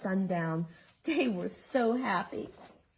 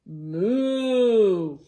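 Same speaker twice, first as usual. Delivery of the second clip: long loud low moo; mooing cow; 3 semitones lower, 9 dB louder than usual. Acted. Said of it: "all she heard was".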